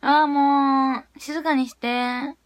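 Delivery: very slow, stretched-out groan; exasperated drawn-out plea. Real, not acted. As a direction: drag the words out in exasperation. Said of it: "お前静かにして".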